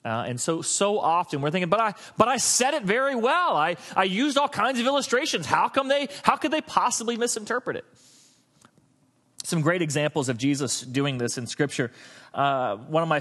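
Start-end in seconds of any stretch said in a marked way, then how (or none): 1.7-7.8 higher-pitched mock indignation; defensive protest. A speaker defending his message to himself, defensive protest. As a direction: protest in disbelief at being misunderstood.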